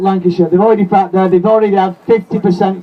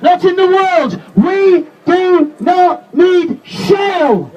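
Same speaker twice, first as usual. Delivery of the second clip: higher and shouted, slowed on the key lines; fiery rally speech; 8 semitones higher than usual. Real, not acted. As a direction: rouse the crowd at full force.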